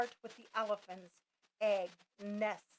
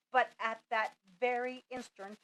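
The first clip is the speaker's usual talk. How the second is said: ordinary delivery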